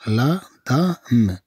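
"لا دام" stretched very slowly; slow teaching pronunciation, broken apart; slow syllables. Shown as a demonstration. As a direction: draw out each sound of "لا دام". Slow take for learners.

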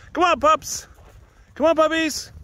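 high-pitched, loud call to puppies; yelling. Real, not acted. Calling distracted puppies away from play, yelling high. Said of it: "Sorry for yelling".

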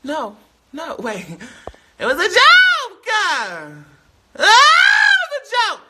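high, slow, drawn-out wail; desperate wail. Real, not acted. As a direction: wail it in panic. Panicked plea after crude joke.

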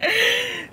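high giggle; embarrassed laugh. Real, not acted. Laughs while fumbling name.

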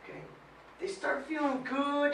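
normal talking voice

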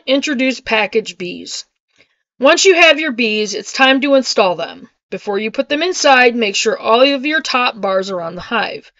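ordinary talk, nothing marked

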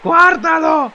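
rising excited shouts; excited shouting. Real, not acted. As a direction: yell it, thrilled. Watching a great goal.

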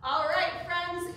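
ordinary talk

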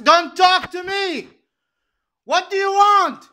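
very loud, high, drawn-out yell; real scream. Real, not acted. Man screaming at someone to stop talking to him, enraged. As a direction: explode into a slow, full-force scream. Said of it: "Sorry for the scream noise".

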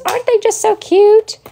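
very high cooing; high, adoring gush; high-pitched gushing. Real, not acted. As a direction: coo in a squeaky voice over them. Gushing over own creations.

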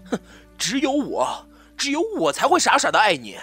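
loud, high, impassioned cry; obsessive outburst. Acted. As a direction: cry out obsessive love at full force. (痴狂地高声呐喊)

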